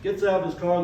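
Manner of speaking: normal talking voice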